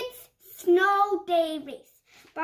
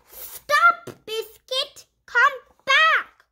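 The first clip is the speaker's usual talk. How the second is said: higher, louder call after the dog; 7 semitones higher, 9 dB louder, much slower than usual. Acted. Owner calling the puppy away from the race, louder and higher. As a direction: call the puppy back across the snow.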